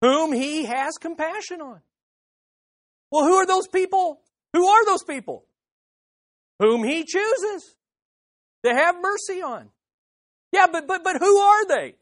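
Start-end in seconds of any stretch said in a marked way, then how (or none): 3.1-5.4 higher, louder questioning challenge; a voiced doubter demanding an answer; objector pressing. 10.5-12.0 higher, louder stammering protest; a doubter pressing the preacher harder; insistent objector. Pretend. Objector voice keeps pushing.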